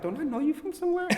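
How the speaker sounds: lightly voiced suspicious passer-by; quizzical stranger. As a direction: squint at a half-familiar face.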